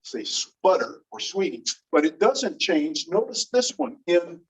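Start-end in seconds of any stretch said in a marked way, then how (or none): none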